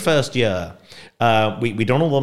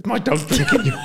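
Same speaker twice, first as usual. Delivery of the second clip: laughing; 13 semitones higher than usual. Real, not acted. Dog drinks guest's water.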